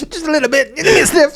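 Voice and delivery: very high, loud, whimpering; hammed-up junkie kid. Dad riffing as addicted kid, squeaky whimpering plea. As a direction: beg for just a sniff.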